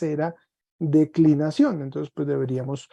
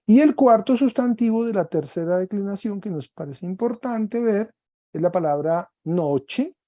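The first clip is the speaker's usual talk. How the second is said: ordinary talk, nothing marked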